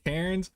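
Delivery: Cairns as CARE-nz, rising high in question; unsure pronunciation. Shown as a demonstration. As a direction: try Cairns as CARE-nz, asking. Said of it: "how do you say".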